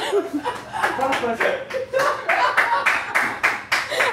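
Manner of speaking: long high helpless laugh; burst of laughter. Real, not acted. Reader losing it over the story, laughing. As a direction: break down laughing at the text. Reader cracks up mid-sentence.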